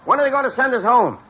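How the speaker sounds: high mock yell from far away; distant yelling. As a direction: call out like voices across a huge pool. Distant voices yelling across pool.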